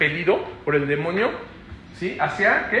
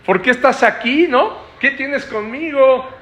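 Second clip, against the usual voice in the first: higher, drawn-out begging; possessed man begging Jesus, voice raised; pleading possessed man; 6 semitones higher, slower than usual. Retold or imitated. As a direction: plead not to be tormented. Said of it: "llegó y dijo".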